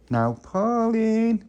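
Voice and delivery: high, drawn-out call at the door. Acted. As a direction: sing out her name slowly. Calling at Pauline's door.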